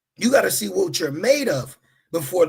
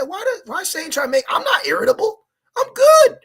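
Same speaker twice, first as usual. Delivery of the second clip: higher and louder, building to a yell on good; indignant retelling; 6 dB louder than usual. Retold or imitated. Preacher retelling his reaction to Satan, rising mock yell. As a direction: insist you are not irritable, rising. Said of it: "I said".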